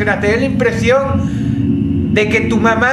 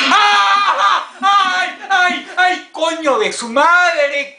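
high shrieks and slow cursing; frightened screaming; 9 semitones higher, much slower than usual. Real, not acted. Gamer screams and curses at a scare.

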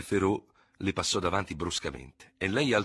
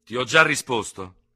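firm, raised but controlled reply; self-controlled; 6 semitones higher, 10 dB louder than usual. A narrator's reading. Dubert refuses the enraged challenger, keeping himself well in check. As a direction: hold your temper while refusing. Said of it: "disse l'altro dominandosi benissimo".

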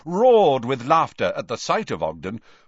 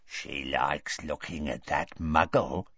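vicious; 8 semitones lower, 6 dB quieter than usual. A narrator's reading. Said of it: "a vicious expression on his face".

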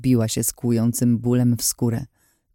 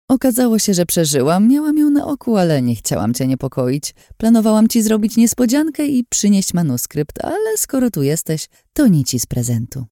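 higher, honeyed scheming woman; sly villainess; 8 semitones higher than usual. A narrator's reading. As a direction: play the sweet-talking liar. Lin's voice held.

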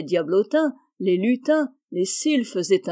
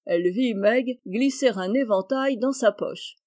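plain reading voice

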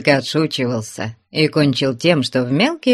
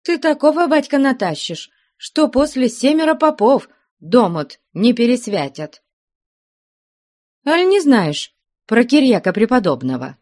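put-on grumbling old wife; 9 semitones higher than usual. A narrator's reading. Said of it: "ворчала".

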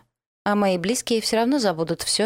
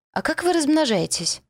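much higher, eager question; eager curiosity; 9 semitones higher than usual. Acted. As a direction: blurt it out with nosy glee. Sudden eager nosy question.

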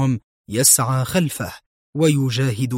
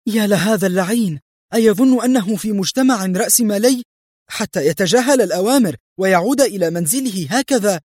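high, irritated rant; acted exasperated outburst, pitched up; edgy anger; 8 semitones higher than usual. A narrator's reading. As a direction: rail indignantly at the absent man. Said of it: "في غضب وهي تقول في عصبية".

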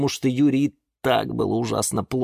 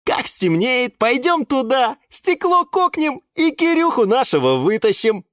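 high, forceful schemer; bold palm character; 4 dB louder than usual. A narrator's reading. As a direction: lay out the raid with gusto. Пальма предлагает план. (высокий напористый голос заводилы)

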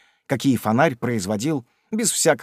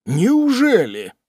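startled; 9 semitones higher, 5 dB louder, much slower than usual. Acted. Pickwick startled by reproach.

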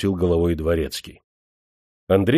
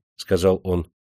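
usual speaking voice

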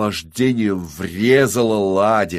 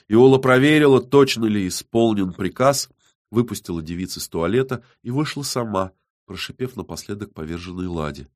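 ordinary delivery

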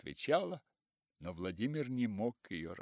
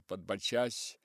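ordinary delivery

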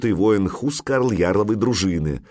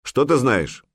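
raised, insistent question; displeased; 5 semitones higher, 3 dB louder than usual. A narrator's reading. The jarl demanding what Greypur knows, displeased. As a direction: press him for the answer, displeased. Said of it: "недовольно произнёс Храгнир-хитрец".

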